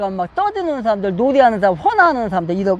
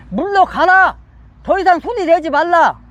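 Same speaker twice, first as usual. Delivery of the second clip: higher-pitched quoted order; commanding; 4 semitones higher than usual. Acted. Preacher as Jesus to flute players and singers, commanding. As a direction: send them away with firm authority.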